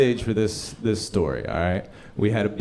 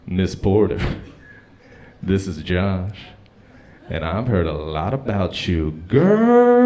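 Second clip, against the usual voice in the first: bass in my voice; 4 semitones lower, 5 dB louder, slower than usual. Retold or imitated. Comic putting on bass to charm coworker's mom. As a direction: put on a smooth low charm. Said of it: "I put a little bass in my voice".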